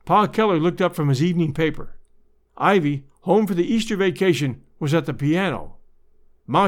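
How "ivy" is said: usual speaking voice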